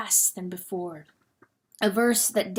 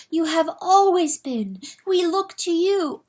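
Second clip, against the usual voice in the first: pleading; 8 semitones higher, 3 dB louder than usual. Acted. Hen pleading with rooster.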